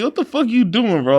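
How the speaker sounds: high-pitched, amused mock confrontation; retold incredulous challenge. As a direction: challenge a friend in animated disbelief. Retelling how he confronted his friend.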